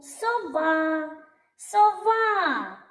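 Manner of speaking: high singsong call of "Сова", long А. Shown as a demonstration. Teacher calls the owl to find stress, high calling "соВАА". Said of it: "Покличемо сову".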